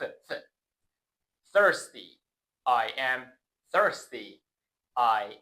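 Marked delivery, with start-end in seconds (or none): 0.0-0.3 soft voiceless "ف" with tongue between teeth; th sound demo. Shown as a demonstration. Teacher demonstrating the voiceless th sound "ف". 1.5-2.2 "Thirsty" with a clear voiceless th; th pronunciation. Shown as a demonstration. Teacher modelling "Thirsty" with careful th. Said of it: "دقت کن".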